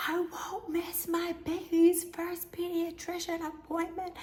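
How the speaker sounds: high, slow, overdramatic whine; dramatic wail. Retold or imitated. Insisting to husband she will go, in a high, slow, melodramatic whine. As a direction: wail it like a tragic heroine. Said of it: "this is so dramatic".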